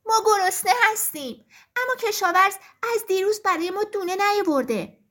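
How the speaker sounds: hungry hens pleading with the rooster; complaining hens. Acted.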